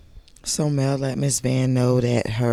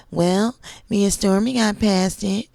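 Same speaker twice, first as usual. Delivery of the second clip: high mocking voice of Marceau; mocking imitation; 11 semitones higher, 4 dB louder than usual. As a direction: mimic him in a higher, whiny voice.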